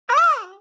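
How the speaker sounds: high squealing cry of delight; ecstatic cry. Real, not acted. Remembering favorite snacks, ecstatic high squeal. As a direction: squeal like the taste is overwhelming.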